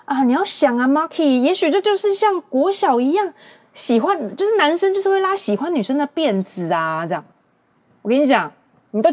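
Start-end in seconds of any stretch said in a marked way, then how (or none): 0.0-7.2 a lightly voiced objecting viewer; imitating a viewer. A viewer arguing back with Marky, lightly voiced. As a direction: play the guy excusing himself with a playground excuse. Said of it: "可能有人说".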